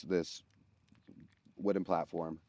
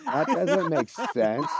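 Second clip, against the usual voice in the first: slow, laughing through the words; can't get it out for laughing; 10 semitones higher, 9 dB louder, slower than usual. Real, not acted. To his co-hosts over the V-lining mixup, laughing slowly.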